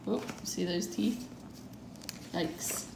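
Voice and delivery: plain reading voice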